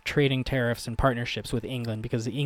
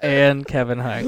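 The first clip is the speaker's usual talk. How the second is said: ordinary talk, nothing marked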